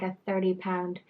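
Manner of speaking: ordinary talk, nothing marked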